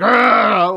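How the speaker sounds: hammed-up monster growl; mock angry growl. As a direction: snarl like looming over a kid.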